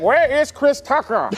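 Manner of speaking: Nelson Mandela. Retold or imitated. Mandela asking after Chris Tucker, slow South African accent. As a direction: stretch each word in a South African accent. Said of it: "he was like".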